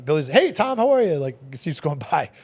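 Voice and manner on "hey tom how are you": high, bright-voiced hello in passing; Billy greeting the manager from the sidewalk; cheery greeting. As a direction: call it out cheerfully as you roll by.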